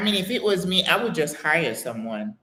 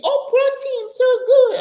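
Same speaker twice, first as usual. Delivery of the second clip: much higher and louder, hammed-up excitement; mock enthusiastic hosts; 7 dB louder than usual. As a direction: imitate them pushing the worms on her. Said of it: "They try to get me saying".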